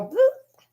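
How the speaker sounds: high, squeaky bloop; silly bloop. Pretend. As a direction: pop it out like a cartoon tongue. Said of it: "Can you go".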